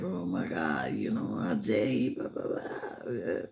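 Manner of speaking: hissed private rant, barely voiced; fuming whisper. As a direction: whisper it, seething under her breath. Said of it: "and she's like".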